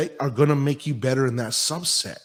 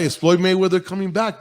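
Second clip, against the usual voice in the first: teasing question; 5 semitones higher, 6 dB louder than usual. Shown as a demonstration. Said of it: "That's the question".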